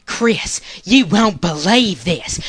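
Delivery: husband imitating his wife's thick Southern drawl; Southern drawl impression. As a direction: stretch every vowel like a Southern woman fresh off the phone. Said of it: "impersonation of Ponzi when she gets off the phone".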